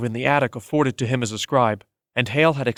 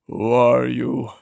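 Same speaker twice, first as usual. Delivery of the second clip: very slow, winded wheeze; breathless after the beating; a spent old man wheezing the question; much slower than usual. Acted. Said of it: "he wheezed".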